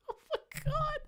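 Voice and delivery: high squeal through laughter; helpless laughter. Real, not acted. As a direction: gasp it out while cracking up. Cracks up after reading.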